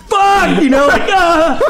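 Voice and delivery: high, strained panicked cry; panicked yelp. Retold or imitated. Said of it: "And I'm fucking like".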